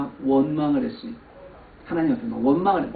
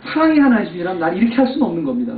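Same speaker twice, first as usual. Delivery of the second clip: raised, louder reproachful plea; complaining to God; 8 semitones higher, 6 dB louder than usual. Retold or imitated. Pastor retelling his resentful prayer, raised reproachful voice. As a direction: demand an answer from God, a bit raised. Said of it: "막 원망을 했어요".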